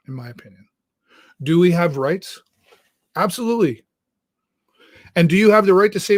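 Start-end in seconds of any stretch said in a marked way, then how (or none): 0.1-0.7 much softer, almost under the breath; trailing off softly. Real, not acted. Host repeating his view to the folks, fading quietly. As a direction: let it fade away to nothing. Repeats the phrase, then fades.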